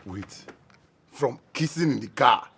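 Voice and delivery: ordinary delivery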